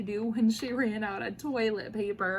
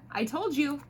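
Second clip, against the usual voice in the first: plain reading voice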